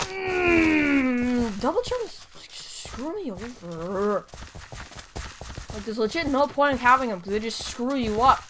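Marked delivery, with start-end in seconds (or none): none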